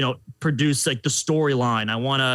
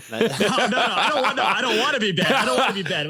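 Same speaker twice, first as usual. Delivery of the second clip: laughing denial; 5 semitones higher than usual. Real, not acted. Told he wants to be bad, laughing protest. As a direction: protest through laughter, higher.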